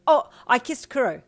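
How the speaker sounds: high, loud sudden blurt; excited blurted confession. Acted. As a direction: blurt it out before thinking. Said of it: "you blurted out".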